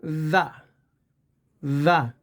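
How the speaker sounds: "ज़ा" with a short, unstressed uh vowel; short schwa ending. Shown as a demonstration. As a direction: say "ज़ा" as a quick weak "the". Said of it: "आवाज़ निकालेंगे".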